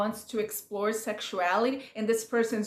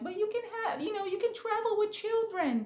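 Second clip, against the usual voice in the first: much higher, softer pleading voice; high mock plea about kids and travel; imitating Chelsea; 10 semitones higher, 3 dB quieter than usual. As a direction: put on her high, earnest plea. Quoting Chelsea's reply to Kwame.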